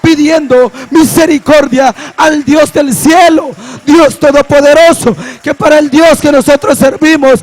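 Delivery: fervent pulpit proclamation; rousing preacher cadence; full-force preaching. Real, not acted. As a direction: build to praise with emphatic, punched phrases.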